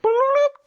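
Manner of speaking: high, loud imitated notification blip; loud, comic electronic bloop; video game bloop. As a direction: pop out a high game-alert noise. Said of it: "video game thing".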